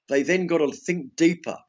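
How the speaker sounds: usual speaking voice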